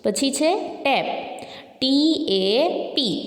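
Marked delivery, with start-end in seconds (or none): 1.8-3.2 slow letter-by-letter spelling. Shown as a demonstration. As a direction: draw out each letter for pupils. Spelling out a word for pupils.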